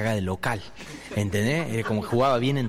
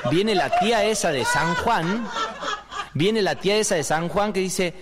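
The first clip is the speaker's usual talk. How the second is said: high, loud churchy aunt voice; preachy aunt; 9 semitones higher, 3 dB louder than usual. Acted. Aunt from San Juan lecturing the family about the soul, high and loud. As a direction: deliver it like a devout old aunt.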